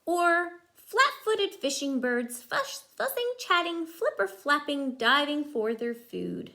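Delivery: lively rhyming picture-book read; reading to children. Acted. Mother reading a bird book to her child, lively rhyme. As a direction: bounce the rhyme for a small child.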